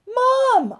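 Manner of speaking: loud, high shout of Mom; child yelling for his mother; yelled. A narrator's reading. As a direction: call out to mother in alarm. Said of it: "I yelled".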